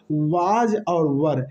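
was and were said very slowly and apart; slow listing. Shown as a demonstration. Teacher naming the English helping verbs, very slowly. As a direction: draw out was और were so students catch them. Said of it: "तो वो आ जाएगा".

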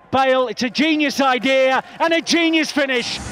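ordinary talk

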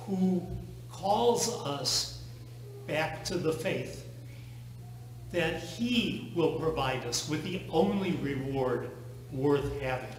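usual speaking voice

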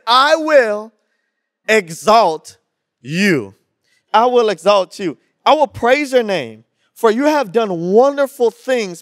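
loud, high scripture proclamation; full-force preaching. Acted. Pastor leads the church in declaring the verse, preaching loud. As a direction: proclaim it and pull the crowd in.

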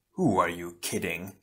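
low, mocking shadow opponent; lightly voiced dark villain; mirthless sneer. A narrator's reading. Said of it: "his opponent chortled mirthlessly".

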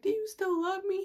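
high, whiny little plea; mock pleading. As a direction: imitate yourself begging for love, high-pitched. Acts out pleading with ex.

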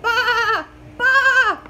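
high, loud bleating baa; rising loud sheep baas. A narrator's reading. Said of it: "But the sheep went baa".

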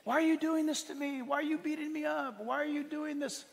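high, whining plea of a man being beaten; pleading victim. Acted. Beaten victim pleads with his attackers, mock desperate whine. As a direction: beg them to stop, pitch way up.

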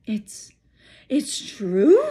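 stammered, disbelieving question; faltering. Acted. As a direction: stumble over the words in shock. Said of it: "faltered Professor McGonagall".

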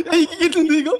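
high, loud laugh through words; excited laughing. Real, not acted. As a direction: cheer with a laugh. Watching fight, laughing.

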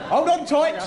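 conductor's brisk passenger call. Acted. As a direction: call out to all aboard.

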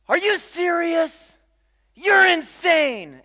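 high, slow shouted disbelief; retold scream. Secretary yelling over the dress, shrill disbelief. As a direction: yell it like you can't believe your eyes. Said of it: "our secretary scream at the front".

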